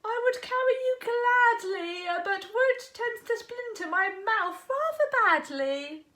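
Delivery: dog explaining he can't carry wood; apologetic dog. Acted. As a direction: let the frog down kindly. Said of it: "said the dog".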